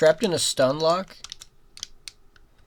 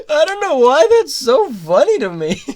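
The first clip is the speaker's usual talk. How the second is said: laughing while talking; 8 semitones higher, 6 dB louder than usual. Real, not acted.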